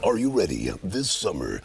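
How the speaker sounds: deep trailer announcer voice; lightly voiced trailer announcer; low booming movie preview voice. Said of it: "Movie previews guy".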